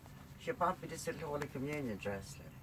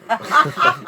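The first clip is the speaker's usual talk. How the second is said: loud high burst of laughter; a big real laugh breaking out; hearty laugh; 12 semitones higher, 19 dB louder than usual. Real, not acted. Burst of laughter at own story.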